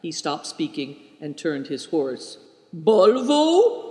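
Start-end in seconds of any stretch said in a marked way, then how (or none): 2.7-3.9 curt, higher, louder question; brusque. A narrator's reading. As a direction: bark it out short. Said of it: "he asked brusquely".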